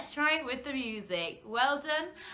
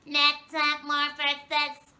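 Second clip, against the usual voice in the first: louder, robotic alien voice; 5 semitones higher, 10 dB louder, slower than usual. Shown as a demonstration. Teacher saying the word like an alien. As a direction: beam it out like a space alien. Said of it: "let's say it like an alien".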